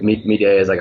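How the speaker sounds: ordinary talk, nothing marked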